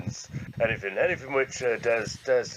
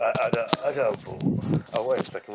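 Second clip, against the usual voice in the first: normal talking voice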